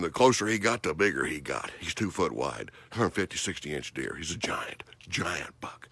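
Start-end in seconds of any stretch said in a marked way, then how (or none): 4.2-5.9 softer, hushed whisper; awed whisper. Real, not acted. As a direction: whisper it in awe of the giant buck. Deer close, staying silent.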